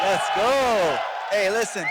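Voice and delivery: fired-up shout. Real, not acted. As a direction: shout to grab the room. Fired-up trash talk at press conference.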